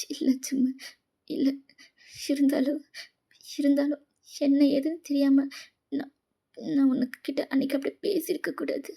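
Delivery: weepy, faltering, repeating words as they catch; crying. Acted. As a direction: blame yourself haltingly, close to sobbing. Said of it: "எதுக்கு அழற?".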